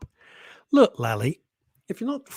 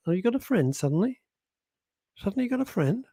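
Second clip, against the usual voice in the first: higher, sarcastic, mock-jealous; mock suspicious teasing; 7 semitones higher, faster than usual. Real, not acted. Host ribbing a listener who declines the show, mock suspicious. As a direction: tease her for suddenly having a friend.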